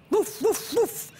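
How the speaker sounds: high barked woofs; dog barking. Acted. The dog on the boat, barking high. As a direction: bark it out bright and big. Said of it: "barked the big, brave dog".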